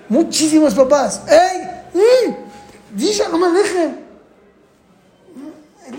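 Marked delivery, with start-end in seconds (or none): 1.3-4.0 grumpy father. Retold or imitated.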